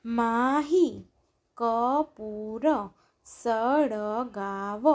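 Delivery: Mahi Kapoor સળગાવ slow, each syllable stretched; drawn-out Mahi Kapoor સળગાવ, syllables separated. Shown as a demonstration. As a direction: sound out Mahi Kapoor સળગાવ slowly, pausing between words. Syllable-by-syllable reading, then blended words.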